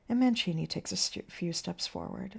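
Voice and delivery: ordinary talk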